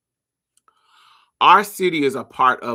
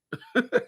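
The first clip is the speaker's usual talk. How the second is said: short laugh; 9 semitones higher, 3 dB quieter than usual. Real, not acted. Laughs at own repeated line.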